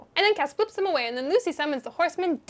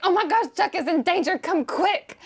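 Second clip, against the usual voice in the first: higher, urgent mock alarm; panicked fangirl; 4 semitones higher, 3 dB louder than usual. Fangirl calling the brothers to save Chuck, mock panic. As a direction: raise the alarm in a high fangirl voice.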